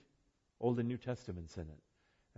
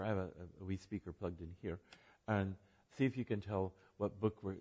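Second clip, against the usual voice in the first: ordinary delivery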